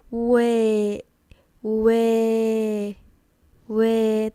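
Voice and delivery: WAY as a long "weh", no final A; WAY cut before its A glide; end glide removed. Shown as a demonstration. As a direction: hold WAY on eh without closing it. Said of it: "take off that A in the last part".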